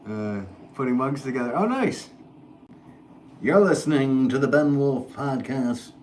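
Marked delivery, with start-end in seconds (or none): 1.5-2.1 high-pitched delighted "oh, nice"; light up with pleasant surprise; excited. Real, not acted. Reacting to a chat message. 3.4-5.9 put-on slow, booming old-time radio announcer; 1950s radio voice. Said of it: "my generic radio voice".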